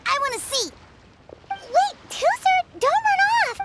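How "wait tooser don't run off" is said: put-on high, raised call to a kid running away; calling after him. Calling after the runaway kid to stop him. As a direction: call him back before he's gone.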